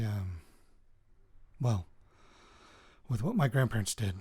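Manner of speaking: ordinary delivery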